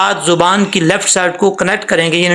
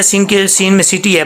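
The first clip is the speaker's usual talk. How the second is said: ordinary delivery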